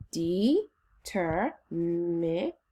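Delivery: determination broken slowly into separate syllables. Shown as a demonstration. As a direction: say "determination" slowly, pausing after every syllable. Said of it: "Say it slowly with me".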